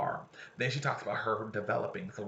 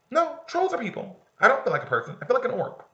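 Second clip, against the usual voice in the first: high, loud protesting; insistent protest; 14 semitones higher, 8 dB louder than usual. Acted. [name] insisting to Kaylee she feels like an orc, high and loud. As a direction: push back hard, worked up. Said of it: "[name] protests to Kaylee".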